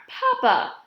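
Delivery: scolding. A narrator's reading. Daughter scolds her teasing father.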